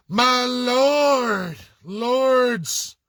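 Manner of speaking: high, drawn-out mock reverence for "My lord"; mock pompous address. Mocking viewers who bow to crown titles, mock courtly reverence. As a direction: lift "My lord" up like a sneering courtier.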